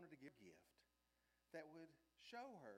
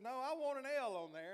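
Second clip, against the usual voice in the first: insistent retold reply; 7 semitones higher, 11 dB louder than usual. Retold reply to tattoo artist.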